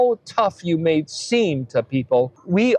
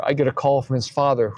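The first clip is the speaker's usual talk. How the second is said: usual speaking voice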